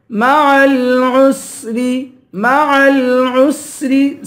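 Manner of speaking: الْعُسْرِ with a long sibilant س; whistled seen. Shown as a demonstration. Teacher showing the letter seen, الْعُسْرِ with whistling س. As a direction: chant مَعَ الْعُسْرِ, sustaining the س. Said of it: "سین کی سیٹی نکالیں گے".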